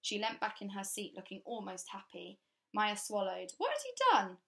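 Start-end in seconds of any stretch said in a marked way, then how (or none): 3.6-4.4 put-on much higher girlish question; girl asking the stern governess, high and polite; young girl's voice. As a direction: ask politely like a shy girl. Child Maya asks the governess.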